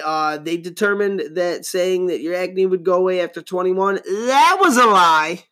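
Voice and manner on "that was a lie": talk show host voice. Pretend. Maury-style announcement.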